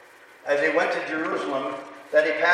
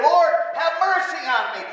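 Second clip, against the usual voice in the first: a much higher, louder cry for mercy; crying out to God like a desperate leper; loud desperate plea; 10 semitones higher than usual. Acted. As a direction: lift your voice up high and beg the Lord. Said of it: "they lifted up their voice and said".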